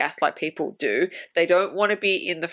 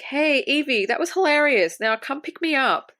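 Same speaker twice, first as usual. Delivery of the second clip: higher-pitched, lightly acted phone greeting; imitated upbeat caller; 7 semitones higher than usual. Imitating Tracy calling Evie from a payphone, upbeat. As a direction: play at laughing off a friend's prank.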